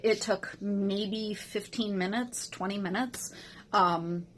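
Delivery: ordinary talk, nothing marked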